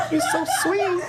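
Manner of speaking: high, soft falsetto coo; mock sweet. To the clingy lover, a high sweet coo.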